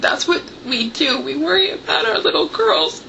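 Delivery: tearful retelling, voice rising; real welling tears, voice climbing at the end; choking up. Real, not acted. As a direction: quote a loving dad while holding back tears. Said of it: "I'm really sorry, you guys".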